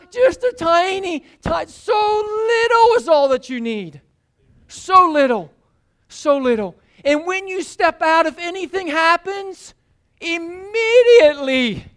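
impassioned sermon delivery; fervent preaching. Acted. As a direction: drive the point home to the congregation. Preaching at full force.

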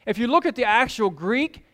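ordinary talk

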